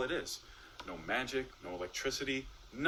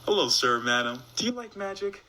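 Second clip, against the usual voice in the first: lightly hammed-up stage magician greeting; magician's patter; 9 semitones higher, 4 dB louder than usual. Magician greets an imaginary couple, showman's patter. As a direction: greet the audience like a showman, rising high on the question.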